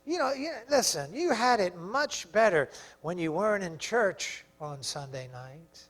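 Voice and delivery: hushed, low, coaxing voice; soft seductive coaxing, slowing to drawn-out words; devil's tempting whisper. Acted. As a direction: murmur temptation into the listener's ear. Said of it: "He's gonna whisper in your ear".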